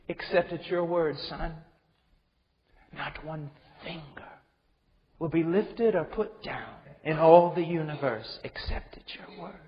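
very soft, falling to a whisper; God speaking softly to the Son; hushed. Acted. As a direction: let it sink to a reverent whisper.

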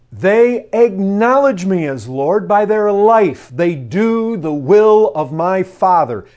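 loud, slow preaching of Jesus' words; full-force preaching. Acted. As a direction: press the warning home to the congregation.

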